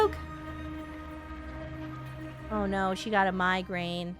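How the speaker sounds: usual speaking voice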